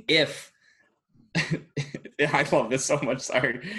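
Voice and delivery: real giggles breaking up the words; too tickled to get the sentence out; laugh, then push on apologetically; giggling delight. Real, not acted. Giggling before favorite scenario.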